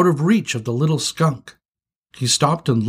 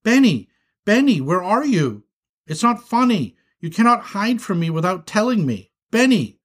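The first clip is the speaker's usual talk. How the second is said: high, anxious calling out; worried, high-pitched scolding search; panicked; 8 semitones higher than usual. Acted. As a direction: call for a missing child in rising alarm. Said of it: "Binky began to panic".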